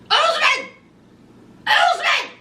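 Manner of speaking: high mock shout of "Usman!"; calling out. Lisa calling from the hallway.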